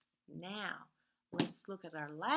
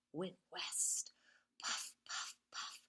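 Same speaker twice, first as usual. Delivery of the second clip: whispered; 5 dB quieter than usual. A narrator's reading. Whispered train refrain.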